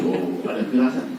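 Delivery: ordinary delivery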